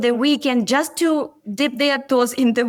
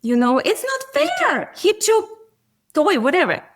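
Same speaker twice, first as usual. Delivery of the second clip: put-on whiny child voice; 8 semitones higher than usual. Son in the back row complaining to mom, high childish whine. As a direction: play the upset little boy crying it's not fair. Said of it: "he's like".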